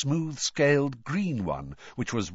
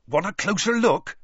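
higher, louder eager question; excited; 9 semitones higher, 5 dB louder than usual. A narrator's reading. Hagrid inviting Madame Maxime closer, excited. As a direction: ask it eagerly. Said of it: "Hagrid asked Madame Maxime excitedly".

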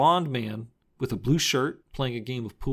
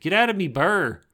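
imitating a bad fake Irish accent; mock Irish brogue, hammed up; terrible Irish accent; 6 semitones higher, 4 dB louder than usual. Recapping the movie to his cohost, bad fake Irish accent. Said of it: "very fake, very terrible Irish accent".

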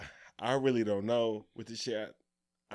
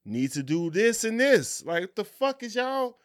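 indignant retort; 7 semitones higher, 4 dB louder than usual. Retold or imitated. Continues acted player's indignant retort.

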